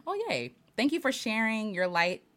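normal talking voice

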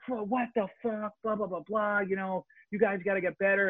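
high mock rant of a furious coach; imitating angry coach. Player retelling the coach yelling at the team, mock rant. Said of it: "just flipping out and going".

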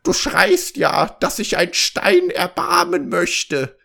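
high, drawn-out frog voice; frog calling out. A narrator's reading. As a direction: call to the princess in a put-on high croak. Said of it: "rief ihr jemand zu". (hohe, gedehnte Froschstimme, rufend)

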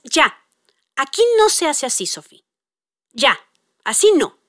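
short, firm phrases with pauses; firm limit-setting. Retold or imitated. Mom stops angry daughter, acted firmness. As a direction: set a boundary without shouting.

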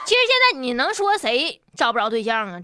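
ordinary delivery

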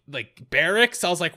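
usual speaking voice

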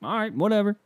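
high, scoffing brush-off; dismissive. Retold or imitated. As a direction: wave it off, whatever. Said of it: "And I go".

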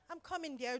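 soft, affectionate call back; sweet, willing. Shown as a demonstration. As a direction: answer your husband tenderly. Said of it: "there are two different words".

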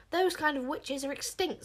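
high, indignant outburst; screaming. A narrator's reading. Witchmeller correcting the queen, screaming. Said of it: "screamed the Witchmeller".

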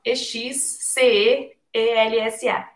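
letter by letter, E X C E E L S A; spelling it out. Shown as a demonstration. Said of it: "Vou soletrar".

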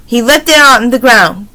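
ordinary delivery

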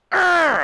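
high, loud "arr" of fury; rage roar. Retold or imitated. Retelling how he raged on the scorpion, rage roar. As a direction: let out a burst of imitated raging. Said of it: "I raged on him".

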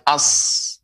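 "as" with a long held s; merged article. Shown as a demonstration. Quran teacher isolates the merged sound, merged article. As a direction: hold the hiss of s in "as". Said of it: "Ya, melebur".

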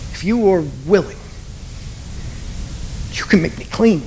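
"willing" leaned on at the end of the phrase; stress on willing. Shown as a demonstration. Preacher working through the line, stressing "willing". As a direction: press on "willing", then pause before "you can make me clean". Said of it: "emphasize each word individually time after".

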